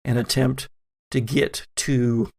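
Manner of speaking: ordinary talk, nothing marked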